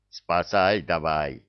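ordinary delivery

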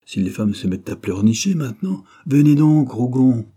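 exasperation building to a loud order; grumble, then loudly summon him; gruff scolding. A narrator's reading. Commander impatient with a clinging wife, scolding and ordering. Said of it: "gronda-t-il".

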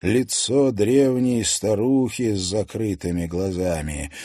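ordinary delivery, nothing marked

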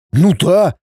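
high-pitched, excited confirmation; agitated. A narrator's reading. Son confirming to his father, agitated. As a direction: burst out with it. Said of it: "возбуждённо заявил Святослав".

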